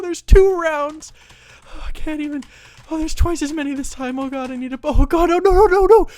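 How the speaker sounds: high-pitched, hammed-up panic; frantic panic. Overwhelmed wizard player facing too many monsters, panicking. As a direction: wail helplessly as it all gets worse, pitch climbing.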